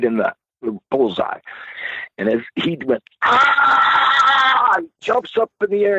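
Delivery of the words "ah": high, loud yelp of shock; pained yelp. Retold or imitated. Paul jabbed with a pencil, high loud yelp. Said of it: "He's going".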